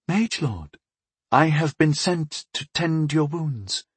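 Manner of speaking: slow, patient explanation. A narrator's reading. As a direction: calmly spell it out. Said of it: "she explained patiently".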